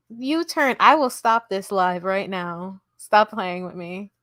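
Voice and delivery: ordinary talk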